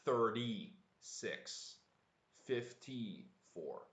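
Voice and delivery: very slow, each number drawn out; slow drill. Shown as a demonstration. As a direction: stretch out thirty-six and fifty-four for the class. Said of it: "the numbers are also good to practice".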